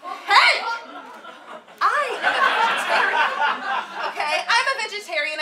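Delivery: loud, offended outburst; angry. Acted. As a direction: snap back, hurt and loud. Couple's quarrel at dinner.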